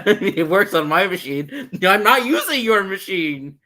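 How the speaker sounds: laughing. Pretend. Mock tech support reply, laughing.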